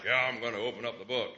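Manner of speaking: low, fast tough-beast voice; hulking sheep. Retold or imitated. Preacher mocks a hulking sheep volunteering for the job, low put-on voice. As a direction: imitate a big hulking ram, pitch dropped. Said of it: "this hulking sheep that's like".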